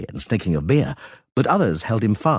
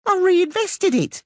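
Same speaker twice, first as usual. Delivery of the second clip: high, slow and proud; lightly voiced proud halfling; slower than usual. A narrator's reading. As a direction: say it with smug pride. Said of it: "Teleko said proudly".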